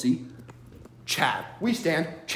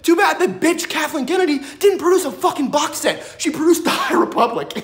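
high, loud mocking outburst; loud mocking yell; loud rant; 8 semitones higher, 6 dB louder than usual. Real, not acted. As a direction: shout it out, gleeful scorn. Ranting at producer.

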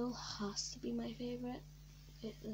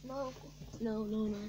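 ordinary delivery

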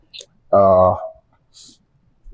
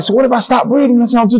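much higher, frightened question to the nurses; a scared patient begging the nurses, high and panicky; panicked question; 11 semitones higher, faster than usual. Retold or imitated. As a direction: ask it high and scared, voice climbing. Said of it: "I'm like".

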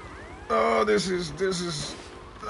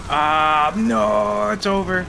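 dismayed outcry; 6 dB louder, much slower than usual. Real, not acted. Dismay as the run fails.

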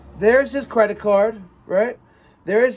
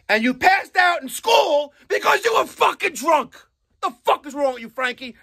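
much higher, voice cracking with anger; angry, near tears; 9 semitones higher than usual. Real, not acted. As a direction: let the fury break into a cry. Exasperated rant at Frankie.

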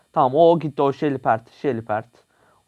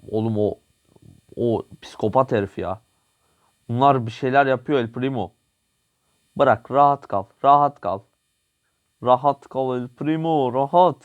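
ordinary talk, nothing marked

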